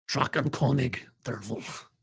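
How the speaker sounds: quiet second try at "Drakenkönig der Wolf" in a fake German accent. Shown as a demonstration. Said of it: "I'm doing my best fake German pronunciation".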